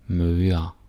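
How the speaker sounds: normal talking voice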